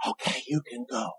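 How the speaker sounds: soft, hushed release; mock tender farewell. Preacher spoofing a Hallmark farewell: hushed and soft.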